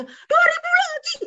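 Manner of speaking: very high, loud mock screech; yelling mother. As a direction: play an exasperated mom shrieking about money. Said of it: "kalau mamanya".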